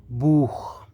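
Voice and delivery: बूख whispered, ending in a throaty kh like German Buch. Shown as a demonstration. Teacher whispering बूख to show students the kh sound. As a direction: breathe out बूख with a rasping kh at the end. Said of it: "C H जो है वो खे की आवाज़".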